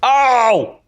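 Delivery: high, loud startled yelp; startled cry. Real, not acted. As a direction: jump at the sudden hit. Caught off guard by enemy.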